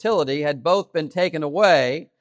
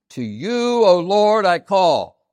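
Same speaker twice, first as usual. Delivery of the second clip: "To you" raised high and loud, drawn out; "you" sung out strongly, "O Lord, I call" following loud; emphasis on YOU; 6 semitones higher, 7 dB louder, much slower than usual. Shown as a demonstration. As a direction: proclaim it, leaning hard on "you". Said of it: "an important emphasis in that sentence".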